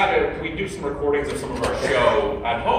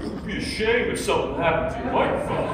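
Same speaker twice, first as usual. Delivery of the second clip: lightly voiced mobster, laughing through it; a hint of a mob threat, half giggling; terrible mob impression; 4 semitones higher than usual. Retelling the checkout pop-up as a mob racket, mock menacing. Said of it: "it's a terrible impression of the mob".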